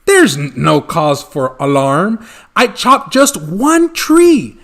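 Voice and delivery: loud flustered excuse; flustered protest. Acted. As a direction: stammer out an excuse loudly. Once-ler defends himself.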